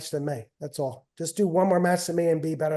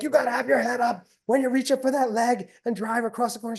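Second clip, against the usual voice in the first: higher, lightly hammed-up coaching instructions; coach mode; 7 semitones higher than usual. Dad slipping back into coach mode, hammed-up instruction. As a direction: rattle off technique like a coach. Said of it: "I'm like".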